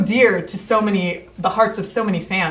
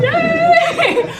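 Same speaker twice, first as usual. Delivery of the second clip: high, loud, laughing cheer; delighted cheer; 7 dB louder than usual. Real, not acted. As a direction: cheer a friend's arrival. Cheers someone's arrival.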